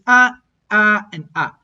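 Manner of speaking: three short clipped ah syllables; Cantonese checked tones. Shown as a demonstration. Teacher demonstrating the Cantonese Rusheng tones on ah. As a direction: clip each ah short and sharp. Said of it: "the Rusheng tone category, which is".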